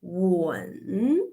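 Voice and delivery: WEN dipping low, then rising; long drawn WEN that sinks and swings up; third tone. Shown as a demonstration. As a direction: let WEN fall, then lift it at the end. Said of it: "if we pronounce the third tone".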